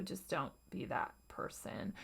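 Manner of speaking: hushed, nagging whisper; whispered inner critic. Retold or imitated. Voicing the inner critic that runs our lives, whispered. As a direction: breathe it like a voice in your head. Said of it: "saying things ... that sound like".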